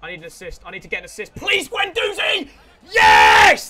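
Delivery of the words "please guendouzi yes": slow high plea bursting into a roar; beg, then explode on the yes; pleading into cheering. Real, not acted. Gamer begging Guendouzi for an assist, plea into cheering shout.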